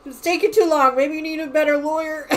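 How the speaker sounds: playful scolding mother-in-law voice; Mama Joyce. Retold or imitated. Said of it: "Mama Joyce made the comment".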